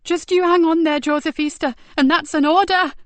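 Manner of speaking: high, loud pleading command; desperate order. A narrator's reading. Nicky ordering her dying sergeant to hang on. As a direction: order him to live. Urging him to hold.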